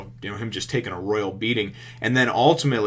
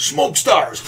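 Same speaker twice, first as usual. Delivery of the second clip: louder, higher battle cry; attack call; 5 semitones higher, 7 dB louder than usual. Retold or imitated. Usopp calls his attack as he escapes, shouted. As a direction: shout the move name.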